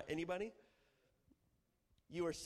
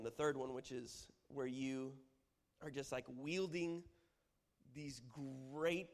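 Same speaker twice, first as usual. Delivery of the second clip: ordinary talk, nothing marked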